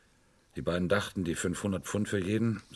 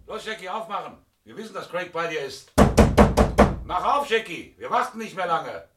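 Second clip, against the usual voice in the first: raised, demanding calls through a closed door, pitched higher; calling through the door; 7 semitones higher, 8 dB louder than usual. Acted. Inspector calling through Jackie's door, raised and demanding. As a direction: insist they open up now.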